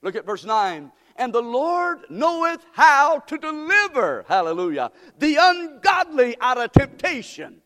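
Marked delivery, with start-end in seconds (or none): 1.2-7.6 preaching at full force. Acted. Preacher proclaiming deliverance to his congregation, fervent. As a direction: proclaim it like good news. Preacher reads verse nine.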